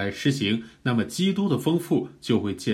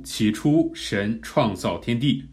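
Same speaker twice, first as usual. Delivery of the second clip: slow, word-by-word reading, pushing each word out; 逐字推出; slower than usual. Shown as a demonstration. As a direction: read it slowly and deliberately, word by word. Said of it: "一个字一个字，用着灵，带着生命，把这个字推出去". (一个字一个字慢慢推出来)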